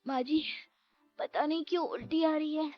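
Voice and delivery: high, queasy complaint; nauseous. Acted. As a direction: complain to mother-in-law as sickness rises. Priya suddenly feels sick. (मितली से परेशान, ऊँची आवाज़)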